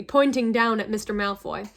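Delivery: ordinary delivery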